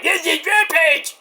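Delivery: drawn-out, high announcer call; fighting game announcer. Retold or imitated. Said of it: "not like".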